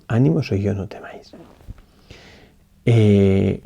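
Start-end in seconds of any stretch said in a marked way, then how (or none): 0.1-1.3 hushed whisper, almost breathless. Shown as a demonstration. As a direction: whisper the words of Jesus gently. (susurrando "Ánimo, soy yo, no temáis")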